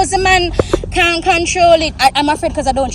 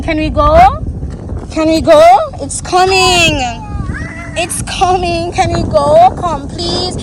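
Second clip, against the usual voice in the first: higher, pleading to get off; frightened mother pleading as a truck comes; real fear; 5 semitones higher, 3 dB louder, slower than usual. Real, not acted. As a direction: beg to leave, scared and urgent. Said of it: "I'm really scared".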